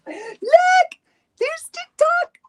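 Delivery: very high, slow coo; high doting voice. Real, not acted. Showing the dog, baby talk.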